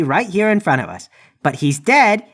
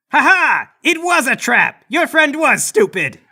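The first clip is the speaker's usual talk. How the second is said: triumphant, drawn-out and higher; gloating; 5 semitones higher, slower than usual. Acted. Player springing the trap on the guard, gloating. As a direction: crow it slowly over the fallen.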